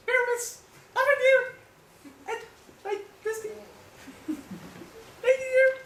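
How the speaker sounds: very high, squeaky little falsetto; mock tiny voice, high and thin; monster's little voice. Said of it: "I'll speak in the monster's little voice".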